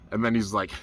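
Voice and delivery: normal talking voice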